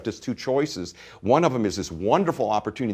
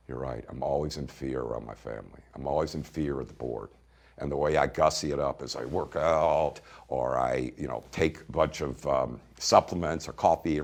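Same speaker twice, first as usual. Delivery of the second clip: much softer, hushed and lower; soft admission; 11 semitones lower, 7 dB quieter than usual. Retold or imitated. Client quietly admitting his fear to the coach. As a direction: confess it quietly, half to yourself. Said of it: "And he just went".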